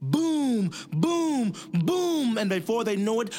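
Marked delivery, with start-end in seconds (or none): 0.0-2.4 much higher, louder booms. Acted. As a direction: let the booms crash in. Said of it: "then it got louder".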